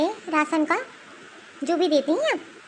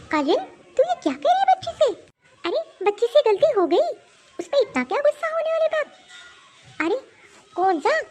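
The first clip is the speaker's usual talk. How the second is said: rebuking; 6 semitones higher than usual. Acted. Grandmother rebukes daughter.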